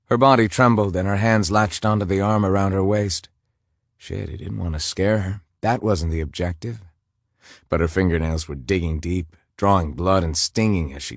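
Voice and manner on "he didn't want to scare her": usual speaking voice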